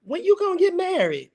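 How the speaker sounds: high-pitched nosy family voice; nagging relatives. Retold or imitated. Host mimics family asking at gatherings, high and nagging.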